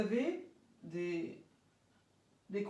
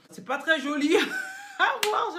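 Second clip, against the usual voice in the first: laughing, high and louder; 9 semitones higher, 9 dB louder than usual. Real, not acted. Teacher laughs at the ugly duck exercise, high and louder. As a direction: laugh through it at the silly face.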